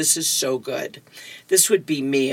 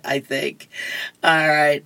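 normal talking voice

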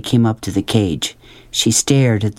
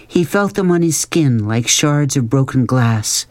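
plain reading voice

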